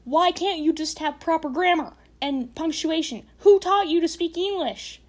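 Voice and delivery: furious grammar rant; ranting shout. Real, not acted. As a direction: blast the sloppy writer. Ranting at bad punctuation.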